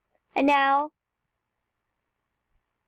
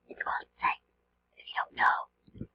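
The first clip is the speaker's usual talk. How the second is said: breathy secret whisper to the viewers; whispered aside; 9 dB quieter than usual. Real, not acted.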